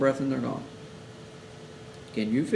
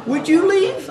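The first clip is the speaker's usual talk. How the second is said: emphatic question; 13 semitones higher, 7 dB louder, slower than usual. Real, not acted. Rhetorical challenge to listeners.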